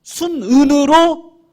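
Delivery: high, loud, stretched-out sermon cry; fiery pulpit delivery; full-force preaching. Acted. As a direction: preach it to the back row.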